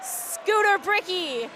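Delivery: building to an excited goal shout; rapid hockey play-by-play. Real, not acted. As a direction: call the goal, stretch the name. Live play-by-play goal call.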